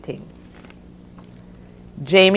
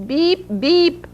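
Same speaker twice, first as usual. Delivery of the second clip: high, punchy car-horn beeps; imitated horn honk; 11 semitones higher than usual. A narrator's reading. Mister Cameron honks at the blocked road, high beeps. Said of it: "honked his horn".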